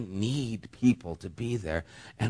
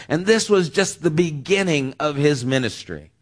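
louder, higher preaching build; 5 semitones higher, 5 dB louder than usual. Acted. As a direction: rise to the climax of the sermon.

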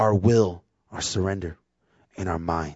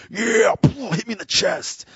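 much higher, straining tough-guy call; tough sports guy; 12 semitones higher, 3 dB louder, slower than usual. Retold or imitated. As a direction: play the rough jock begging for a hit. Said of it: "I wanna be".

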